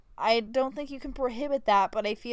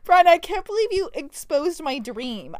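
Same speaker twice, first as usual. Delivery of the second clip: higher, louder hammed-up betrayal; playful betrayed complaint; mock outrage; 6 semitones higher, 6 dB louder than usual. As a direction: scold her in play. Mock outrage at being exposed.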